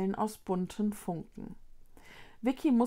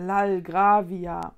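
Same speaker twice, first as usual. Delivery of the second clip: tipsy teacher; 4 dB louder, much slower than usual. Acted. Tipsy teacher repeats odd word.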